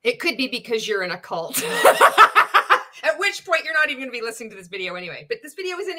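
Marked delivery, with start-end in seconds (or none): 1.7-3.0 loud, high burst of laughter; big laugh. Real, not acted. Speaker joking about a cult, laughing hard. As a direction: crack up loudly at your own jibe.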